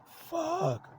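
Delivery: very high, strained mock scream; imitated scream. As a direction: cry it out high without full volume. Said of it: "screaming, crying, yelling, like".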